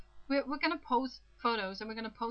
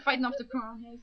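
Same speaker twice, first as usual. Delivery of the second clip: ordinary delivery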